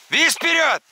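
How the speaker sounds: loud high call to the dog; shouted dog command. Real, not acted. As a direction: yell it across the water.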